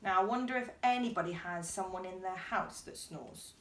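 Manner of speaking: usual speaking voice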